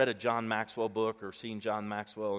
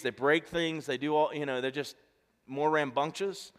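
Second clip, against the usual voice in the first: ordinary talk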